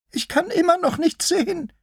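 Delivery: very high little sheep voice; high, helpless lamb; complaining sheep-lion. Acted. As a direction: complain in a tiny high-pitched voice.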